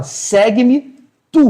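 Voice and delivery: segue-me drawn out, tu weighted; slow stress on tu. Retold or imitated. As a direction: lean slowly onto the final tu. Jesus ordena a Pedro. (Jesus a Pedro: "segue-me tu", lento, com peso no tu)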